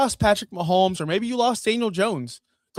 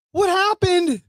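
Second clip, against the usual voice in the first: incredulous; 11 semitones higher, 4 dB louder, slower than usual. Real, not acted. Disbelief at big game.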